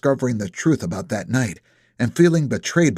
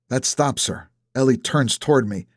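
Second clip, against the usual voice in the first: ordinary delivery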